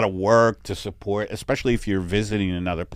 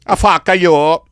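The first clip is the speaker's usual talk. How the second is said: ordinary talk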